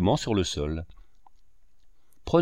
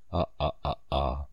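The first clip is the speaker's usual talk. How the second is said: mocking laugh; 8 semitones lower, 7 dB quieter than usual. A narrator's reading. Fauvarc laughing at naive Jeanne, low mocking laugh. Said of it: "Tout en riant et parlant".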